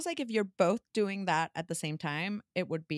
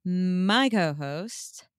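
radio voice; 3 dB louder than usual. Pretend. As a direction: stretch key words like a radio presenter. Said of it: "I'm trying some radio voice".

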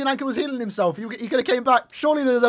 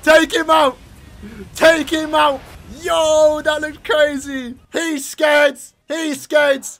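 loud, high celebratory shouting; hyped cheering; 5 semitones higher, 8 dB louder, much slower than usual. Real, not acted. Fan cheering Rukia to take him out, shouting excitedly. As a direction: yell them on at full voice.

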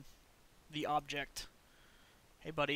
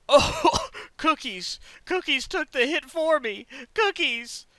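excited; 10 semitones higher, 13 dB louder, slower than usual. Real, not acted. Teammate took the hit.

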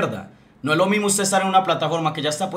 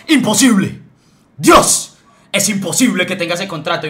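shouting in disbelief, much higher and louder; mock yell; 3 semitones higher, 8 dB louder than usual. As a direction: yell it like a man who can't believe a contract. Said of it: "salió gritando". (grito burlón de incredulidad, mucho más agudo y fuerte)

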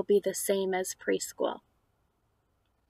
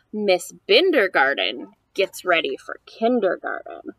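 lively, louder picture-book reading; reading to children; 6 dB louder than usual. Acted. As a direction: read the rhymes aloud to a small child. Reads picture book aloud.

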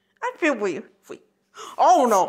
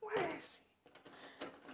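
whisper; 3 semitones higher, 28 dB quieter, much slower than usual. Acted. Searching quietly for Douglas.

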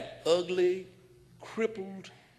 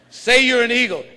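louder, half laughing order; loud laughing command; 6 semitones higher, 13 dB louder than usual. Retold or imitated. The man loudly ordering the bird to say it. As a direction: push him hard, grinning.

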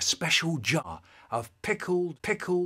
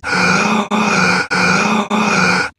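loud, heaving gasps; loud breathing; 5 semitones higher, 13 dB louder than usual. Pretend. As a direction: breathe as loudly as possible to be heard. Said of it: "I start breathing really loudly".

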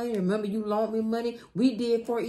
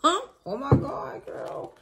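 ordinary talk

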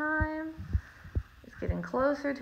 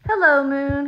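loud, called up to someone far away; shouted greeting; 3 semitones higher, 8 dB louder than usual. A narrator's reading. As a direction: call out hello, loudly. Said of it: "he shouted".

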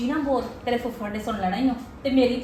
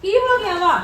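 high, louder alarmed call; 7 semitones higher, 6 dB louder than usual. Acted. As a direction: rush in alarmed at the noise. Aunt bursts in on shouting. (ਘਬਰਾਈ ਹੋਈ ਉੱਚੀ ਆਵਾਜ਼)

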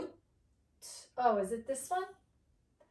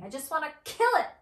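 fast, high, louder outburst; acted irritation; 4 semitones higher, 5 dB louder, much faster than usual. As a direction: blurt it in mock exasperation. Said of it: "I'm very full of feeling today".

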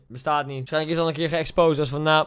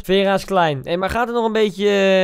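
usual speaking voice

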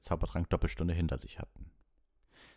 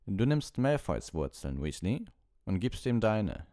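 slow, raised, deliberate order; ominous; 5 semitones higher, 4 dB louder than usual. A narrator's reading. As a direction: stretch the command out ominously. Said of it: "unheilverkündend an".

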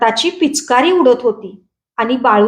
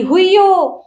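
drawn-out, raised exclamation; mild crowd cry; 6 semitones higher, slower than usual. A narrator's reading. The whole crowd cries out as carts race, mild cry. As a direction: stretch it out like a crowd's shout. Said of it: "असा एकच आवाज झाला".

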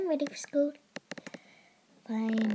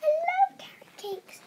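high delighted voice; 4 dB louder than usual. Acted. Character delight.

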